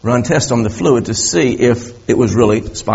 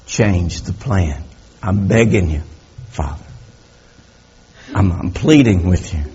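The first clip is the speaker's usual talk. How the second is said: pleading prayer; 5 semitones lower, 5 dB quieter than usual. Acted. Jesus begging the Father in the garden, hushed.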